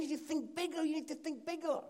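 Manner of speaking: high, insistent, repeated push; urgent urging. Retold or imitated. Mark told to think bigger, high urgent urging.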